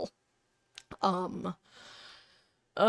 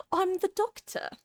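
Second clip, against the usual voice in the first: a higher, hammed-up British "I'm the Doctor"; 10 semitones higher than usual. Streamer attempting British voices, playing the Doctor. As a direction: quote the time lord with a grin. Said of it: "Doctor Who".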